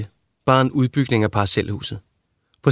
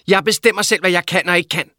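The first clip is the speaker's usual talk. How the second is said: mother's voice raised in a quarrel; angry mother; 7 semitones higher than usual. A narrator's reading. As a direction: snap back at her son.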